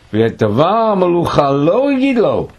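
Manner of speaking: high, loud verse recitation; declaimed scripture. Acted. Teacher quoting what Saul kept secret, declaimed. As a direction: ring out the verse to the room. Reading the Hebrew verse aloud.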